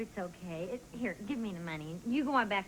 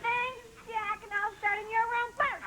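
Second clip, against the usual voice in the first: higher, sing-song parody of the roommate's sweet thanks; mocking mimicry; 12 semitones higher than usual. [name] mocks Cindy's chirpy thanks to Jack, in a higher parody voice. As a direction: mimic her line in a higher, sugary voice.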